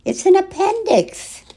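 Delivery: high urgent doctor voice; doctor's alarm. Acted. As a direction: sound the alarm, pitched high. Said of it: "he said".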